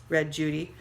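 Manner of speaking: ordinary delivery, nothing marked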